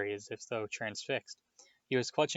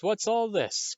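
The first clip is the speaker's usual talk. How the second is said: much higher, booming hearty call; higher jovial repeat; 10 semitones higher, 4 dB louder, slower than usual. Acted. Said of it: "thundered jovially".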